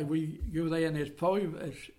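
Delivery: ordinary delivery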